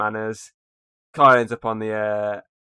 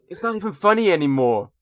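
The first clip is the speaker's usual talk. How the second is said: higher-pitched mock exasperation; Alan annoyed; 7 semitones higher, 3 dB louder than usual. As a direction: imitate someone fed up with the joke. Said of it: "annoys Alan".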